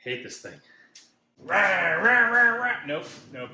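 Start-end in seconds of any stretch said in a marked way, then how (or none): 1.4-2.8 engine noise imitation. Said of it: "that's not the chainsaw, that's me".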